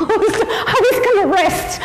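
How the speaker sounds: high giggling words; laughing. Real, not acted. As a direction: laugh as you explain you wanted to rest. Laughs being pulled back.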